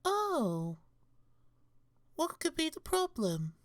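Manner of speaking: high-pitched, suddenly doting commander; suddenly sweet demeanor. Acted. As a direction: switch to a high cooing voice. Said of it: "his voice and demeanor suddenly changed".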